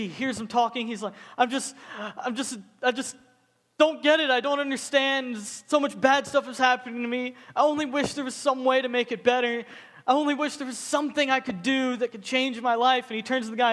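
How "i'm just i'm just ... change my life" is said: high, whiny fake sobbing; mock crying. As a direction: blubber through it like a hammed-up crier. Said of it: "he's just crying and sobbing".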